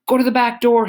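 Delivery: shouted instruction over the fire; calling out. A narrator's reading. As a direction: call across to the others to go. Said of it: "he calls to us".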